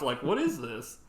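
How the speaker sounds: ordinary talk, nothing marked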